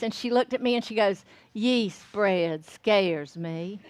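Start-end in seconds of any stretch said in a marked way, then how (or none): 1.5-3.8 very slow, low Southern drawl; mother's Southern drawl. Retold or imitated. Mother answering daughter's offer to teach her, very slow Southern drawl. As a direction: imitate a Southern mother, stretching each word way out. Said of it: "just like that".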